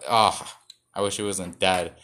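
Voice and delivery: usual speaking voice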